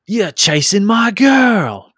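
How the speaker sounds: high, slow, loud shouted warning; angry shout. Acted. Rider confronting the player chasing his girl, high slow shout. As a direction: bark it slowly at the stranger chasing her. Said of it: "he looks at you and he says".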